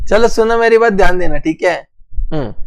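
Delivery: normal talking voice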